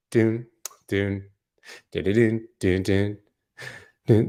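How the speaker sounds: mock suspense jingle; sung dramatic sting. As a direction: hum a dramatic dun dun dun. Mimicking dramatic musical sting.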